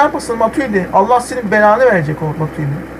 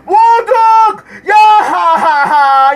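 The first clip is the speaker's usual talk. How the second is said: loud high cry turning into a slow shouted laugh; erupting as the shot goes in; excited yell; 10 semitones higher, 5 dB louder, much slower than usual. Real, not acted. Gamer shouting and laughing as his team shoots.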